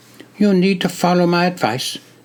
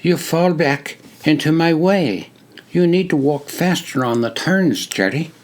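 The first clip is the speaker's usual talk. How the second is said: put-on higher, crisp British-like accent giving advice; explaining in a British-like accent; 6 semitones higher than usual. A narrator's reading. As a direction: lecture a fellow recruit in a British-like accent. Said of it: "crisp, British-like accent".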